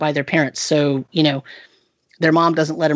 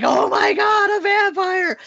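much higher, thrilled squeal; mock-freaking-out, pitched way up; imitating an excited kid; slower than usual. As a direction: squeal it like a boy who loves vampires. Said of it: "And he freaked out".

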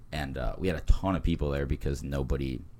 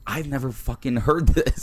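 incredulous; 8 semitones higher, 6 dB louder than usual. Retold or imitated. Acting out his baffled reaction.

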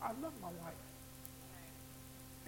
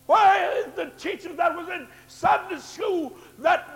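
louder, higher, building to a shout; preaching at full force; 5 semitones higher, 12 dB louder than usual. Acted. Pastor demanding the church find missing members, in a climbing preaching shout. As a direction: drive each question home to the pews.

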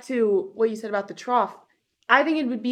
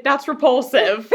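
slow, louder verdict through laughter; laughing disgust; 3 semitones higher, 7 dB louder, slower than usual. Real, not acted. Co-host reacts to a gross listener letter, laughing disgust. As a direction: pronounce it slowly, breaking into a laugh.

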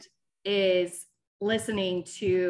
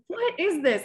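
baffled; 8 semitones higher than usual. Retold or imitated. Retelling her confused reaction.

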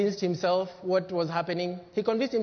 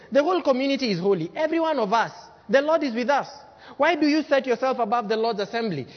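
raised, louder, fast indignant protest; Dathan's indignant challenge; 5 semitones higher, 4 dB louder than usual. Acted. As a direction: throw the challenge at Moses like an agitator. Preacher voicing rebel Dathan.

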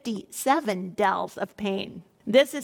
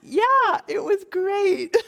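laughing; 8 semitones higher, 4 dB louder, slower than usual. Real, not acted. Laughs off husband's question.

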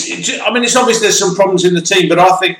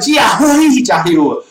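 Giovanni shouted high and stretched; mock Italian names; 6 semitones higher, 3 dB louder than usual. Mocking commentators mangling Italian names, loud. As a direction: ham up Giovanni, Giorgio like a fake Italian. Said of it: "it's like".

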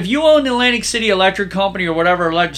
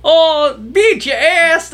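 mock salesman; 9 semitones higher, 4 dB louder, much slower than usual. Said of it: "he goes".

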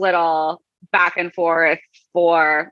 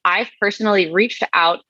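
normal talking voice

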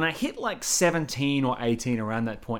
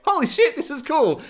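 very high, loud delighted squeal; amazed crew; 8 dB louder than usual. Retold or imitated. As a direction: shriek it like thrilled onlookers. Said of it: "and everyone's like".

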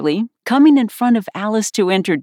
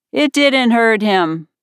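drawn-out, higher-pitched dismissal; careless; 6 semitones higher, 4 dB louder, slower than usual. A narrator's reading. Unicorn dismissing the King's reproach, carelessly. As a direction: brush it off airily, taking your time. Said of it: "the unicorn said carelessly".

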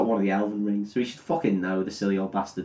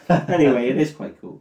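ordinary delivery

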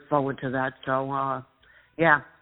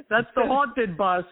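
laughing; 6 semitones higher, slower than usual. Real, not acted. Says it while laughing.

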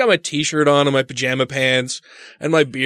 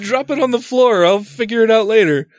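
higher flustered wife; 7 semitones higher than usual. Retold or imitated. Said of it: "She's like".

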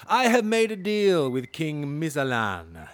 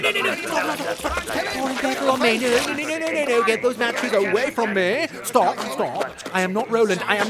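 panicked; 9 semitones higher, 6 dB louder than usual. Acted. Panicking as he's seized.

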